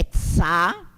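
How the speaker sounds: "tsa" like the end of pizza. Shown as a demonstration. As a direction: model "tsa" for the class, t into s. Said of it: "repitam comigo".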